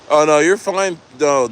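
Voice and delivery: usual speaking voice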